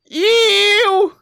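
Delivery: long, very high squeal; disgusted shriek. Acted. Reader can't contemplate the implication, shrieking in disgust. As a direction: let out one piercing cry of revulsion.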